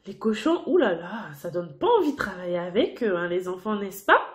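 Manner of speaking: low mock-disgusted, fast; teacher's playful yuck; disgusted aside. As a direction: pull a face at the dirty pigs.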